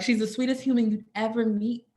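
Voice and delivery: ordinary delivery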